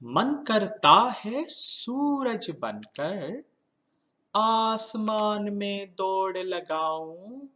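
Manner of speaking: slow singsong verse with drawn-out line endings; poem recitation. Shown as a demonstration. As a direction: recite it for children, stretching the words with lilting pauses.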